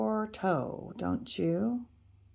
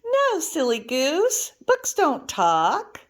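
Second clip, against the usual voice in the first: high, slow teasing of a silly goose; playful teasing; 8 semitones higher, 5 dB louder, slower than usual. Acted. Reader teasing a child, playful. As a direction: tease the child gently.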